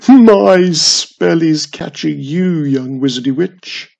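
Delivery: gloating. A narrator's reading. The old tree gloating as the spell chases her. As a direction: savour it, menacing. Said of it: "laughed again".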